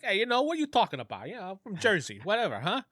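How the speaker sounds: lightly put-on Jersey accent, brash; a hint of a New Jersey mobster. Player mocking a friend's fake accent, in a light Jersey mobster accent. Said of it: "He's actually an Italian mobster".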